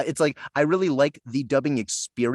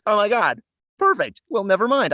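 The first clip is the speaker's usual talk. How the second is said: much higher and louder, delighted; excited relief; 9 semitones higher, 5 dB louder than usual. Retold or imitated. Retelling his delight at seeing the casting, higher and louder. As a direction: burst out thrilled, pitch jumping up.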